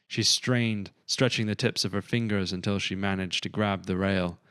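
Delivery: usual speaking voice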